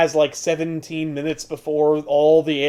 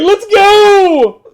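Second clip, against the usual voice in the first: much higher, loud mock shout; 9 dB louder than usual. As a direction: bark it out, hammed up. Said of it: "He's like".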